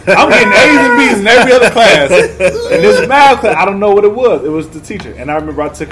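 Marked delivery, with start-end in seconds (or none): 0.1-2.1 higher and louder, laughing; retold outburst. Son defending his grades to his dad, loud and laughing. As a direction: protest hotly to dad. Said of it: "I said".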